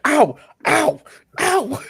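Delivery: mock pained yelps. Player mocks the boss's pain.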